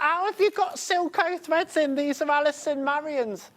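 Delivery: high-pitched excited outburst; thrilled, voice shooting up. Retold or imitated. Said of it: "I was so excited".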